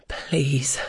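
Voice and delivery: breathless whisper; whispered plea. Acted. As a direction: plead in a ragged whisper. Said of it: "stammer out between ragged pants".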